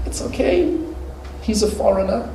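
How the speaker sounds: a shopkeeper excusing a foreign customer to himself; patronising shopkeeper. Retold or imitated. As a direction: brush it off sweetly, it's only a foreigner. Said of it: "the shopkeeper will be going".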